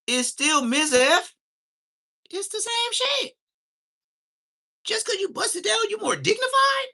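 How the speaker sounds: high, loud mocking squeal that builds to a shout; high-pitched mock outrage. Mocking a crushed-weed smoker.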